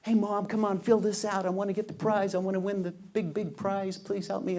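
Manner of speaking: put-on high, loud begging child; mock child pleading with mom; pleading kid voice. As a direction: whine like a kid wanting the prize.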